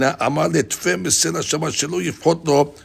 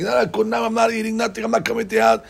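higher-pitched imitation of a guest declining; mock refusal; 7 semitones higher, 3 dB quieter than usual. Guest turning down a friend's invitation to eat, mock refusal. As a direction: wave off the invitation in a raised pitch.

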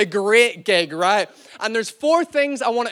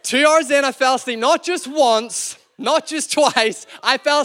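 higher and louder, punching the confession; booming repeat for effect; loud emphatic repeat; 5 semitones higher, 5 dB louder than usual. Shown as a demonstration. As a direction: call it out to the church.

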